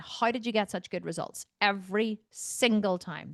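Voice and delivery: usual speaking voice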